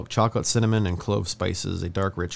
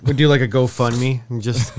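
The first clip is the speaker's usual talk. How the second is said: ordinary talk, nothing marked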